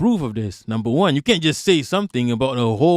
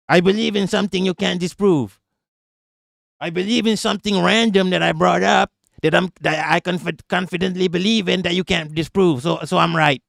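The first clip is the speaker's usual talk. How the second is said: lightly voiced smug know-it-all; mock overconfident Yankee. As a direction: parody their baseless confidence, lightly. Mocking Yankee overconfident reasoning.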